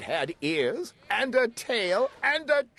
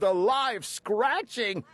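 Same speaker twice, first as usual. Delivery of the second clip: plain reading voice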